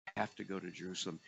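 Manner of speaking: very soft and quick, almost a whisper; hushed resolve. Acted. Preacher echoing Jesus's resolve, hushed and firm. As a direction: say it like a quiet vow.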